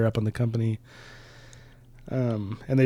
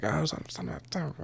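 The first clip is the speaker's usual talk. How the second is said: higher, softer imitated stammer; retold stuttering; 5 semitones higher, 7 dB quieter than usual. Dusk dodges a warning shot, imitated stuttering. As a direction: stumble over an unfinished curse, mock flustered. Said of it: "I kinda imagine him stuttering".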